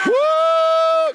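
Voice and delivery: high, loud whooping cry; exultant woo. Real, not acted. Preacher whooping to fire up the congregation, loud and high. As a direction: let out a joyful whoop.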